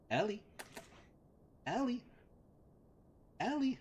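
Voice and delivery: high singsong-pitched name call; very high, coaxing call; calling the dog. Real, not acted.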